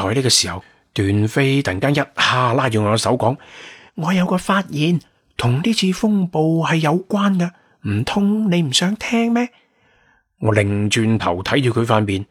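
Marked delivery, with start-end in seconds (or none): 4.0-9.5 put-on high-pitched teasing young woman; provocative. A narrator's reading. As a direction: dangle a secret in a high, girlish voice. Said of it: "明顯喺度挑釁". (高音女仔聲，帶啲挑釁)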